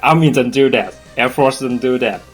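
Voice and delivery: usual speaking voice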